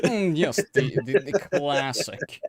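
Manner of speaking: pompous, drawn-out connoisseur; snobby critic voice. Pretend. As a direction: play a stuffy film snob. Mocking snobby film critics.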